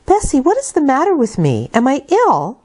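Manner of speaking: put-on a frightened girl asking if she is ill; young Jane asking. Young Jane asks Bessie.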